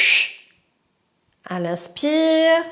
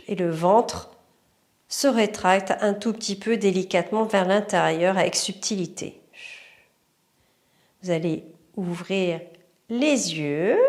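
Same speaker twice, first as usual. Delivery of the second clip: guided meditation; 3 semitones lower, 3 dB quieter than usual. Acted. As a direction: lead the breathing calmly. Guided breathing exercise.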